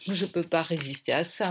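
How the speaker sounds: ordinary delivery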